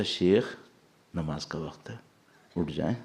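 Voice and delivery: very soft, low, gentle rousing; loving wake-up. Retold or imitated. The heart waking the sheikh for prayer, soft and loving. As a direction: wake the sleeper tenderly, like a mother. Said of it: "اتنے پیار سے اٹھا".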